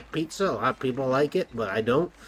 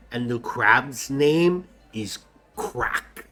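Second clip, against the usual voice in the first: drawn-out secret telling; slow conspiratorial secret; much slower than usual. Acted. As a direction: stretch it out like gossip.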